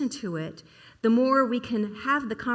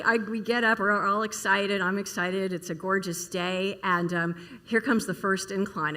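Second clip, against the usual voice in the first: ordinary talk, nothing marked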